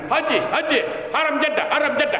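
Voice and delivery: quick, high driver's call to pilgrims; fast taxi driver call. Retold or imitated. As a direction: rattle it off like a taxi tout at Jeddah. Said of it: "Cuma dia cepat".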